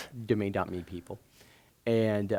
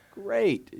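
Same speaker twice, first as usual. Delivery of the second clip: sarcastic great; 12 semitones higher, 4 dB louder than usual. Retold or imitated. Sarcastic reply to Pumpkinhead Inc.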